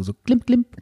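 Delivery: high, playful tinkle noise; tinkling sound. Retold or imitated. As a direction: mimic a little chime, klimp klimp. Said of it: "assoziiert man da immer so ein Klimpern zu".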